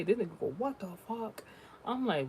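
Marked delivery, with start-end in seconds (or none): 0.6-1.4 driver's shock. Retold or imitated. Imitating the driver.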